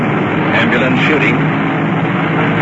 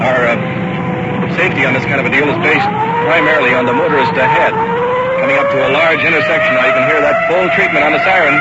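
raised voice over siren; 7 semitones higher than usual. Real, not acted. Talking over a loud siren.

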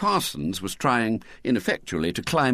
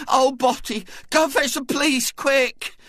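tearful Missus Bott; 4 dB louder than usual. A narrator's reading. Missus Bott tearfully telling her husband of the kidnapping. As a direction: quaver it out like a frightened mother.

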